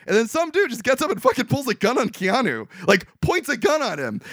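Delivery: normal talking voice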